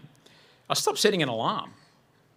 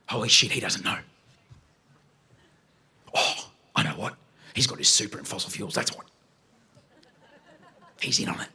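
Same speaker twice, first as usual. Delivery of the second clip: paranoid suspicion; 4 semitones lower than usual. Retold or imitated. Paranoid thought about the psychologist.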